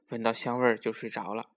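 usual speaking voice

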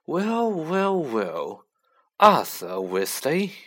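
lightly voiced sneering aristocrat, slow drawn-out greeting; a hint of cold contempt, savouring the name; sneering Lucius Malfoy. A narrator's reading. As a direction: greet your rival with a slow, mild sneer. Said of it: "sneering in just the same way".

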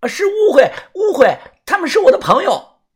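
high, loud, flustered cry; panicked shout. A narrator's reading. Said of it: "慌张地大喊".

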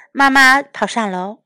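usual speaking voice

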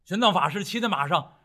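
plain reading voice

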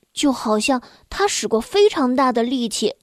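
ordinary delivery

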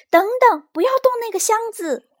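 very high, loud shriek; panicked scream. A narrator's reading. Child shrieking at monster to leave the toy box, panicked scream. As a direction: scream to stop them urgently. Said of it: "萝卜连忙尖叫起来".

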